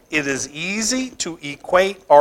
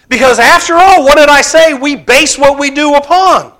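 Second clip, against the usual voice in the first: higher and louder pulpit challenge; rousing rhetorical question; 10 semitones higher, 11 dB louder than usual. Acted. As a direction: throw the question out to the whole room. Preacher poses rhetorical question.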